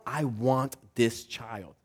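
soft, slow and tender; tender adoptive parent. Retold or imitated. Adoptive parent quietly choosing a child, tender and slow. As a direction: say it like a promise to the child. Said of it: "They say".

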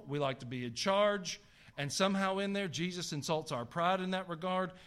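usual speaking voice

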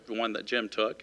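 usual speaking voice

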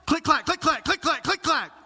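high, loud clicks and clacks of a stuck gas pump; pump clicking noises. Retold or imitated. Pastor reenacting squeezing the dead pump, loud mock clicks. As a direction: rattle off the pump's click-clack.

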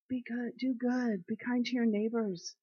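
tender sermon plea; gentle exhortation. Acted. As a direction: urge them gently to be kind. Gentle exhortation after scripture.

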